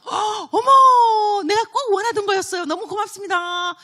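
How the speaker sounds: overjoyed shriek of thanks for a longed-for gift; delighted gift reaction. Shown as a demonstration. Preacher showing the congregation how to greet a gift, in an overjoyed shriek. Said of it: "선물을 받을 때는 리액션이 뭐냐면".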